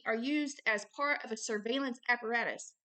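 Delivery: usual speaking voice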